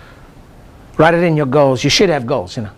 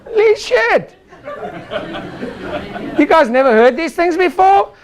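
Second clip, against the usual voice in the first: hammed-up surprise; mock astonishment; 12 semitones higher than usual. Real, not acted. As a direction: feign amazement at the laughing crowd. Mock surprise at crowd laughter.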